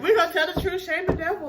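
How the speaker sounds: loud, high and laughing; laughing declaration. Real, not acted. Owning up among joking friends, laughing declaration. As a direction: declare it through a big laugh.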